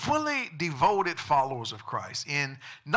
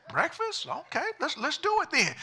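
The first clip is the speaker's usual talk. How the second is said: high-pitched questioning, then agreeing; mildly surprised, high pig voice; 10 semitones higher than usual. Acted. Pig hesitantly takes up the idea.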